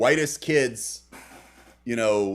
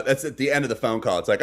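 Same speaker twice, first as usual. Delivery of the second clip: ordinary talk, nothing marked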